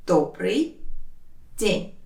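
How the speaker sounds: «Добрый», long pause, «день»; slow teaching breakdown of «Добрый день»; word by word. Shown as a demonstration. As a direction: say each word on its own. Teacher separates words for learners.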